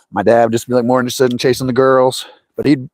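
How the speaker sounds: ordinary delivery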